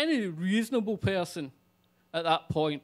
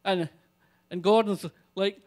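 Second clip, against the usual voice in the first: ordinary talk, nothing marked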